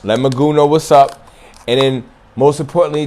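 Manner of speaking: ordinary talk, nothing marked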